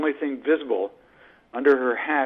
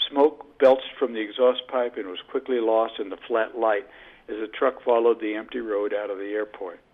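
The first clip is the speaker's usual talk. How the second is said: usual speaking voice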